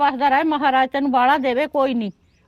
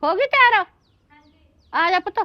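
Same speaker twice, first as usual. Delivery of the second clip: calling out; 5 semitones higher than usual. Acted. Mother calls daughter from inside.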